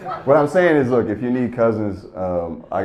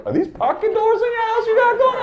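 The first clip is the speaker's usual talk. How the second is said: much higher put-on voice for the nosy firefighter; goofy firefighter voice. Firefighter nosing around the house, in a much higher goofy voice. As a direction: squeak it out like a dopey fireman poking around.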